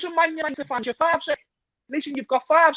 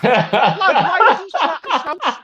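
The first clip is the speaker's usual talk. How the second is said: laughing while ranting, louder; outrage breaking into laughter; 5 dB louder than usual. Real, not acted. Ranting about a rival fan's Scouse video, laughing.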